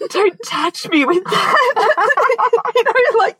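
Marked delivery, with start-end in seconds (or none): 0.1-1.6 high, drawn-out mock disgust; disgusted recoil. Wife recoiling from her husband's bushy beard, mock disgusted. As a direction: recoil from the touch, slowly and high-pitched, half laughing.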